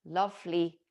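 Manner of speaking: ordinary talk, nothing marked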